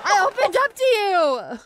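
high, hurt outburst; betrayed friend crying out at Topanga; betrayed wail. Acted. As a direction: cry out at a friend who lied. Betrayed character.

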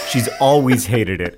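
ordinary talk

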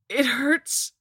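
high, loud wail of hurt; pained cry. A narrator's reading. Stabbed Dudley writhing on the altar, crying out high and loud. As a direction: cry out in agony.

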